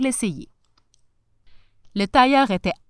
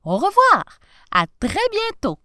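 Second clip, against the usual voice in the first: high, calling goodbye; joyful send-off, pitched way up; cheery farewell; 10 semitones higher, 6 dB louder than usual. A narrator's reading. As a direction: call out a happy goodbye to the wife. Professor waves goodbye to wife.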